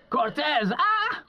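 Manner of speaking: high shrill-free piercing yell of a name; yelling boss. Retold or imitated. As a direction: bark the name like a furious foreman. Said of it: "start yelling at me right off the bat".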